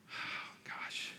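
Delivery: very soft, whispered exclamation; whispered aside. Real, not acted. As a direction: whisper it to yourself, overcome. Overcome aside while reading scripture.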